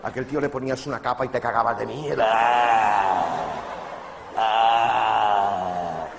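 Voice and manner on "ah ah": loud growling roar; monster roar. Acted. Comedian playing the scary president as a monster, roaring loudly. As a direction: roar to frighten the players.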